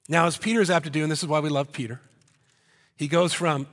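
normal talking voice

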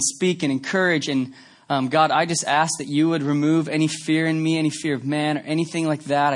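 hushed pastor's prayer. Real, not acted. Opening prayer before sermon.

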